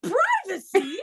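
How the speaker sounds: mock outrage at the word "Privacy?", with a rising question; incredulous echo. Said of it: "I'm like".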